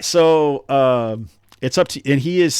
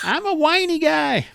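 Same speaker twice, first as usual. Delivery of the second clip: very high put-on whine; hammed-up whiny little complaint; Erk, whiny voice. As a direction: whine it way up high, playing it for laughs. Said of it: "it's more of a whiny voice".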